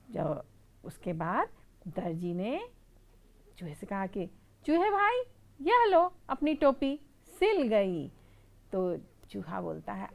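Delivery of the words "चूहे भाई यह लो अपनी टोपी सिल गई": friendly tailor. Acted. Tailor hands over cap.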